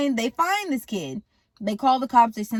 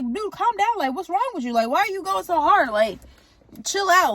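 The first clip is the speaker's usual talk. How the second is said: exasperated mom; 7 semitones higher than usual. Retold or imitated. Mom tells frantic dad to calm down.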